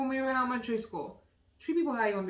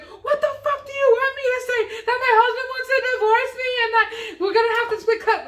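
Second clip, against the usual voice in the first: loud, high, hammed-up wail; mock drunken meltdown, wailing at full volume; imitated crying outburst; 12 semitones higher, 7 dB louder than usual. Crying coworker snapping at happy hour, mock wailing shout. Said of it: "She just starts crying".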